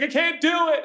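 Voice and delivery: mock kid giving up; whiny child. Daughter lying in the dust, whining that she's done.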